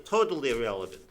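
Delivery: ordinary talk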